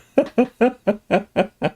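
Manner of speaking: high, rhythmic chuckling; real laugh. Real, not acted. Host greeting his friend in chat, happy laugh. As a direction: chuckle in a quick high string.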